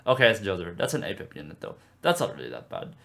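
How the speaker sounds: ordinary talk, nothing marked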